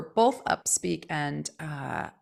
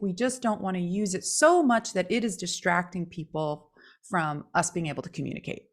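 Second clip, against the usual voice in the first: ordinary delivery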